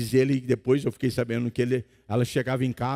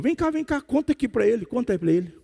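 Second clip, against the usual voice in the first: urgent calling over; 10 semitones higher than usual. Retold or imitated. Pastor urgently calling someone over.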